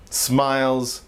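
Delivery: ordinary talk, nothing marked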